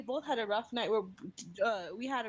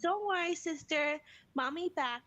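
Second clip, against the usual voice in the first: put-on higher, toddler-style reassurance; young daughter soothing; 6 semitones higher than usual. Big sister comforting crying baby, higher imitated child voice. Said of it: "And the sister started crying. She's like".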